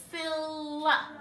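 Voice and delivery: "sylla" with both parts drawn out; sounded-out syllables. Shown as a demonstration. Teacher pointing out the second syllable, "sylla" sounded out in two beats. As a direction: stretch "sylla" to land on the second part. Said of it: "The second syllable is".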